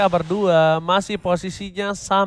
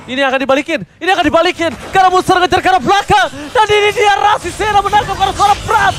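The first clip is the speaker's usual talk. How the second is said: rising hype, yelling the comeback; excited shout; 6 semitones higher, 5 dB louder than usual. Real, not acted. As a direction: scream it like a big play. Caster erupts at comeback.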